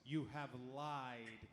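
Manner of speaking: low and grave; serious low accusation. Acted. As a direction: deliver it gravely, quietly.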